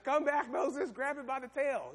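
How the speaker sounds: God calling Moses back to the snake. Acted.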